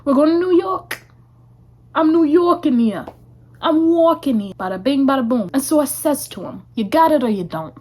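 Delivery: put-on New York accent. Vlogger doing an awful New York accent while heading to New York. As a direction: play a bad New York tough guy, only a hint of New York. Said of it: "My accents are awful".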